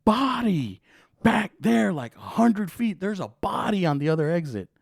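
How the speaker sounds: high, loud, imitated alarm; urgent alarm. Passer-by telling cop about a body on the road, urgent. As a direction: call it out stretched over the traffic. Acts out man reporting a body.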